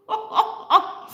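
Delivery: high, sheepish burst of laughter; quick string of high ha-has. Real, not acted. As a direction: laugh off your own outburst, up high. Laughs at own outburst.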